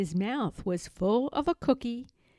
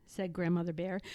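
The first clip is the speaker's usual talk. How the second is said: ordinary delivery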